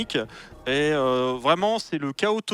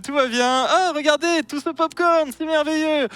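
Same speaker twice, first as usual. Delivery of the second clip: much higher, louder, fawning chatter; high nervous voice; 8 semitones higher, 3 dB louder than usual. Acted. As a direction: babble nervously to please the dragon.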